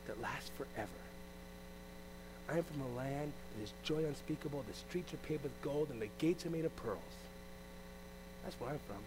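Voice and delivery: soft, dreamy telling of heaven; murmured like a secret treasure; hushed testimony. Pretend. Believer softly answering a curious stranger, hushed and dreamy.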